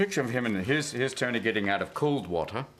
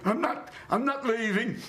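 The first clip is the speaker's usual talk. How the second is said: high, strained mock defiance; acted stubborn refusal, pitched right up; defiant fighter; 13 semitones higher than usual. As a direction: play a battered boxer refusing to quit. Acts stubborn comic refusing to leave.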